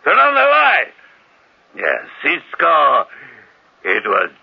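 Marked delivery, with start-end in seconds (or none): 0.0-0.9 much higher, loud repeated shout; shouted order. Acted. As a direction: yell it again, impatient. Repeated order, more insistent.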